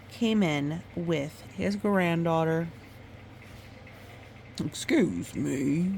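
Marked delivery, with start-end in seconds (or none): 4.6-5.9 grandfather voice. Acted. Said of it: "he said".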